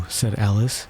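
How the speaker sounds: ordinary delivery, nothing marked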